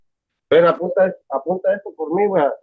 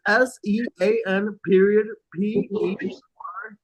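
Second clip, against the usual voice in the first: reading back; 5 semitones higher, slower than usual. Shown as a demonstration. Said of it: "write down your email".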